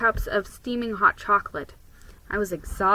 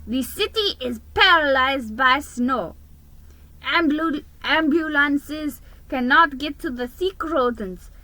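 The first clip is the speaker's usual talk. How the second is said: higher, louder official voice of the mayor; mayor announcing; 5 semitones higher, 4 dB louder than usual. A narrator's reading. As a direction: address the whole city from the podium. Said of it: "he announced".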